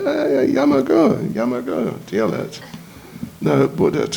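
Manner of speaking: ordinary delivery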